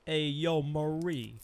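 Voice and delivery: big Italian-American shout-out, played for laughs; Italian-American accent. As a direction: call out to someone in a hammed-up Italian-American accent. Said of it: "my stupid Italian accent".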